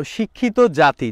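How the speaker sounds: ordinary talk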